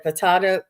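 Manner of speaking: "Potato" said as puh-TAH-toh; open A vowel. Shown as a demonstration. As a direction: middle vowel of "Potato" as in father. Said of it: "Just Visiting said".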